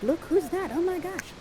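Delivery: hushed, high-pitched gossip in the ear; soft, high, thrilled aside; excited whispering. Acted. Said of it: "is whispering in your ear like".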